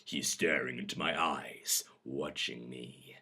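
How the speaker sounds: dramatic audiobook reading; measured, expressive storyteller; story narration. A narrator's reading. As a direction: read an intimate romance scene aloud. Reads a story aloud.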